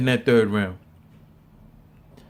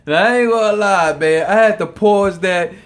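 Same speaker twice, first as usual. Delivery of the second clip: still laughing; 4 semitones higher, 5 dB louder than usual. Real, not acted. Laughing hard after the punchline.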